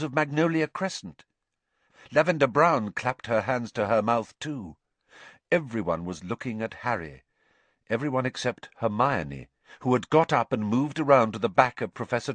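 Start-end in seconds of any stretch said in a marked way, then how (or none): none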